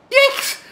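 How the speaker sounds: very high, loud yelp of fright; a squeal of sudden panic; startled shriek. Acted. Punk jumping at the old man behind him, shrieking.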